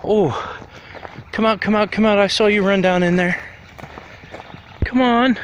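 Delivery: high, sing-song coaxing to a chipmunk; coaxing call. Real, not acted. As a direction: call the little critter out gently.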